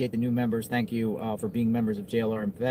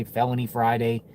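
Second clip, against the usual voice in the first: usual speaking voice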